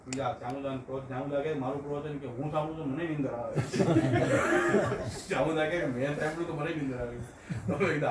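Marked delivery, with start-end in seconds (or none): 3.6-5.2 loud, high burst of laughter; real laughter. Real, not acted. Speaker amused by driver dozing to a sermon, loud high laugh. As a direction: burst out laughing at your own tale.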